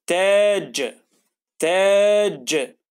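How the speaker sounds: تاج slow, the ta drawn out; stretched تاج. Shown as a demonstration. Teacher reading تاج with a long, slow vowel. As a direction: hold the a in تاج. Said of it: "طولنا في صوت التاء".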